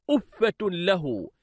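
slow أفة له, with أفة ending in "-tun"; feminine nominative tanween. Shown as a demonstration. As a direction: say أفة له slowly as "uffatun lahu". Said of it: "مؤنثة مرفوعة".

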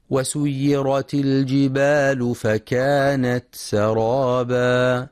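slow tajweed chant; Quran recitation. Shown as a demonstration. Teacher recites the verse for students, slow chant. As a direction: intone the verse smoothly.

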